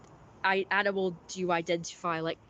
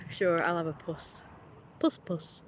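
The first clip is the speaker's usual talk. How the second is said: ordinary delivery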